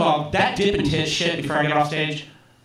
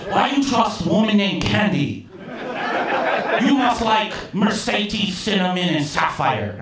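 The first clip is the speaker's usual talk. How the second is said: scolding boss in a mimicked Chinese accent; Big Chen; 5 semitones higher than usual. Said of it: "I'm just imitating his actual voice".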